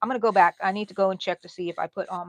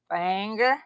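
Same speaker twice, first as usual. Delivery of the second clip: thick Texan twang on "Finger", rising, like "fang-er"; country Texan accent, "Finger" with a wide "a" vowel; 6 dB louder than usual. Retold or imitated. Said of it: "That's my Texan word".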